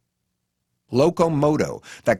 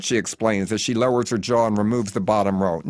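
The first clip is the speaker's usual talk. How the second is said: ordinary delivery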